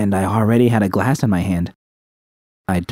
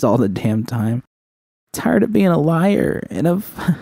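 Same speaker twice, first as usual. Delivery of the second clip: higher, strained, breaking voice; tearful confession; 9 semitones higher than usual. Acted. Judy tells V she wants to change, tearful and strained. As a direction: confess it as it hurts to say.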